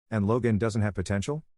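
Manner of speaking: ordinary delivery, nothing marked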